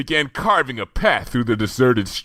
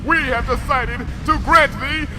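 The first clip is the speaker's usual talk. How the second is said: higher, grand archaic royal voice; regal Princess Luna; 3 dB louder than usual. A narrator's reading. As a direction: declaim like a stately monarch. Princess Luna grants a boon.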